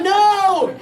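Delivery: high, loud, drawn-out no; exasperated outburst. Retold or imitated. Patient asked the same question by the doctor, exasperated outburst. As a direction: burst out in mock exasperation.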